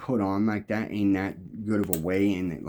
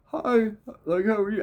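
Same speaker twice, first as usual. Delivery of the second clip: mock stranger greeting; 12 semitones higher than usual. Stranger greets him at smoke table.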